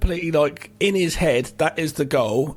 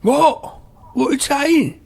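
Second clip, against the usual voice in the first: mock panicked yell; 11 semitones higher, 4 dB louder than usual. Hammed-up panic on the phone.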